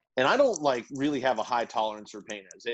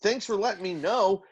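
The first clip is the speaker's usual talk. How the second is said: mock-offended sarcasm at the news; indignant disbelief; 7 semitones higher than usual. Son retelling dad hiding his operation, indignant. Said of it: "And I was like".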